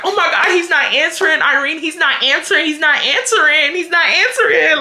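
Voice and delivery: mock panic, high and repetitive; freaking out. Imitating her friend when he won't pick up, freaking out. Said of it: "she starts freaking out".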